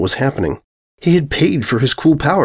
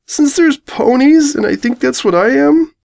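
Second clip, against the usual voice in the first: higher, slow pleading; 3 dB louder than usual. Acted. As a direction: beg gently to be let in. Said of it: "pleading now".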